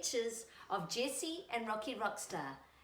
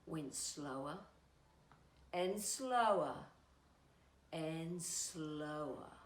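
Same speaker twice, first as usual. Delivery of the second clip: slowing down; 5 semitones lower, 5 dB quieter, slower than usual. A narrator's reading. Train slowing, read slowing.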